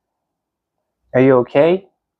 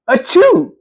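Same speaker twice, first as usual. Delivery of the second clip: sneeze; 7 semitones higher than usual. Acted. Character sneezing.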